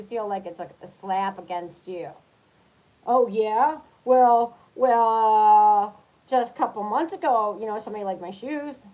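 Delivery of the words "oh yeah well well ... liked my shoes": loud defensive one-upping; mock competitive brag. Jealous lover one-upping their partner, loud and defensive. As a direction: puff up to top your partner's news.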